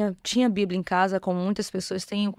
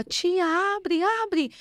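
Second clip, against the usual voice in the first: put-on child shouting; 10 semitones higher, much slower than usual. Little niece begging her aunt to open the door, high mock shout. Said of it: "E ela gritava".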